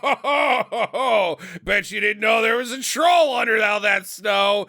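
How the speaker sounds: loud, mock-triumphant guffaw; gloating troll reveal. Buried troll surprising the Megatroll, loud hammed-up gloating. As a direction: crow it like a hidden troll springing out.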